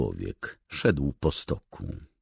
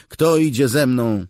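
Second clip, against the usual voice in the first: loud, high rallying call; 12 semitones higher, 10 dB louder than usual. A narrator's reading. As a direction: call for volunteers at full voice. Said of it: "zawołała". (głośne wołanie do żołnierzy)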